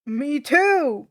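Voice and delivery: a hint of a loud, simple giant voice; lightly voiced Tyson. A narrator's reading. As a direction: chime in eagerly. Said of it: "Tyson says".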